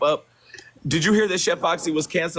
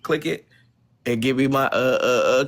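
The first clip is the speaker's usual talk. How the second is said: ordinary delivery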